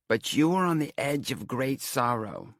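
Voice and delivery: high, loud, slow Romany seer; portentous fortune-teller, Romany accent; ominous Romany accent. A narrator's reading. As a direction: draw out the warning in a Romany accent. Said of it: "doing the old emphasis thing".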